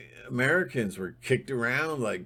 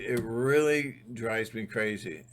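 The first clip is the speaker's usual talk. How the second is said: ordinary delivery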